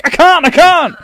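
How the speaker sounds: high, loud panicked cry. Retold or imitated. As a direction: panic as the button is missed. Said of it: "I'm like".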